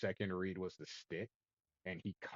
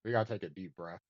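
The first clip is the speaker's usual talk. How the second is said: ordinary talk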